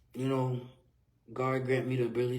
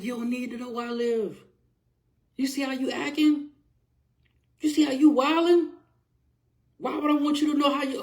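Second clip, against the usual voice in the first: higher, heated comeback at the grandmother; angry retort; 9 semitones higher, 7 dB louder than usual. Retold or imitated. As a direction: fire it back, fed up and indignant. Father arguing back at grandmother.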